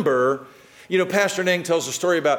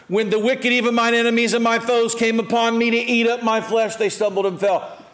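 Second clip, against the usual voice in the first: higher and louder scripture reading; psalm read aloud; 6 semitones higher, 4 dB louder than usual. Real, not acted. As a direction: proclaim the verse to the church.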